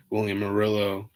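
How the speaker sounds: ordinary talk, nothing marked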